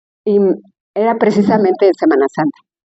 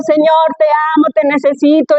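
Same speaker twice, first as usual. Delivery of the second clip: imitated crying out to God, raised pitch; clamando a gritos; 6 semitones higher than usual. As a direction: mimic a woman's urgent, high prayer cry. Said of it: "ella decía que clamando y gritando". (imitando un clamor en voz aguda)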